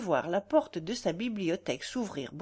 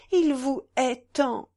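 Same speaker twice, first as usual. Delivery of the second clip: high, loud, drawn-out anguish; acted tearful outburst, stretched out; choked with tears; 5 semitones higher, 6 dB louder, slower than usual. A narrator's reading. Woman fearing his opponent's hatred, choked with tears. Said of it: "Les larmes l'étouffèrent".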